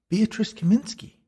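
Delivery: very high voice with a rising lilt; ghost woman. A narrator's reading. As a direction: prompt him in a high female voice. Narrator voices the female ghost.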